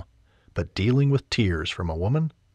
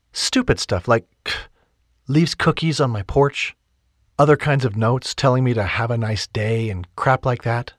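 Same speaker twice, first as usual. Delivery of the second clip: lightly voiced heroine; 4 semitones higher, 5 dB louder than usual.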